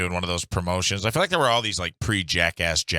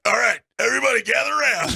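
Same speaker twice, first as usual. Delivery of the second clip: much higher, shouted call to the crowd; 14 semitones higher, 4 dB louder, faster than usual. Retold or imitated. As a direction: summon everyone over loudly. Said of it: "this guy's like".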